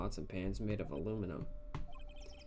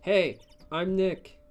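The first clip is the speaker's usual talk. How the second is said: slow, loud, higher-pitched hello; drawn-out, raised-voice introduction; louder call; 8 semitones higher, 8 dB louder, much slower than usual. Acted. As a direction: say it loud enough to trigger a microphone. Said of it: "Maybe I'm not saying it loud enough".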